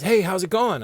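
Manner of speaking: mock cheery boss; lightly imitated carefree boss; breezy boss greeting. Boss voiced in retold story.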